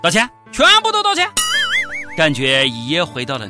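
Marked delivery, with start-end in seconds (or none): 0.0-1.3 fast, high excited cry; frantic outburst. Acted. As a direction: rattle it off in a frenzy.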